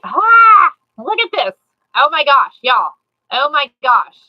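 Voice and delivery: loud, thrilled gasps over the reveal; excited. Real, not acted. Reveals finished rope piece.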